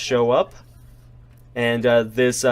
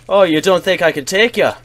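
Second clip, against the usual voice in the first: loud, higher indignant tavern tough; affronted, raised-voice dare; offended challenge; 6 semitones higher, 6 dB louder, faster than usual. Acted. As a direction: take offence and square up to him. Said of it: "The man is clearly offended".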